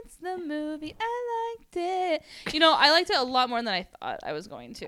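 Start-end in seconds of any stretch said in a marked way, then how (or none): 1.0-2.5 playful drawn-out echo. Pretend.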